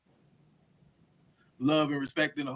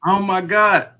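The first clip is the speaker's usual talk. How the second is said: loud frustrated exclaim; exasperated; 6 semitones higher, 10 dB louder, slower than usual. Real, not acted. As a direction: burst out in frustration. Connection trouble.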